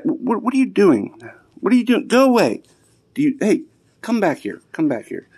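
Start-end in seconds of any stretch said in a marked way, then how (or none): 0.0-2.6 sudden exasperated outburst at the dogs; startled exasperation. Real, not acted. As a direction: caught off guard by the dog, exasperated. Dog interrupts, exasperated owner.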